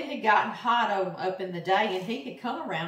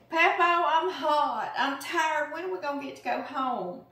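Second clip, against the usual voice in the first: higher voiced granddaughter begging to leave; tired little girl; 6 semitones higher than usual. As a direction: whine like a worn-out little girl. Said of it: "She had gotten tired".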